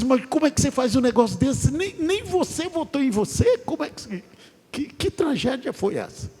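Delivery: mock indignation. Man scolding a candidate who didn't vote for himself, hammed-up high exasperation.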